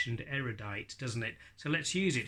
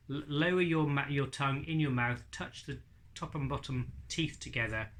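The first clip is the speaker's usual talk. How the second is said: usual speaking voice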